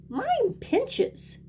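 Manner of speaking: put-on child whining that her swimsuit pinches; little girl. A narrator's reading. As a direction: squeak it like a small girl. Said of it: "Kiki says".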